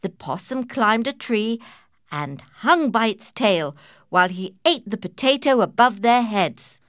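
plain reading voice

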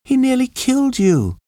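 high shaken voice; frightened. A narrator's reading. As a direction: cry it out in alarm. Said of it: "screamed Kathy".

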